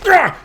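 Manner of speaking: short, loud, high mock yelp; fleeing bear cry. Bear books it out of the ditch, startled yelp. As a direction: imitate a bear yelping as it bolts.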